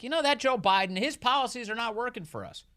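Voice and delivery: high, pinched mayoral impression; a light Eric Adams impression. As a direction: mimic the mayor's complaint. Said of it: "in that Eric Adams voice".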